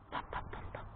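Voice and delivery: soft unvoiced pops; coaxing whispered pops. Real, not acted. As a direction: whisper each pop.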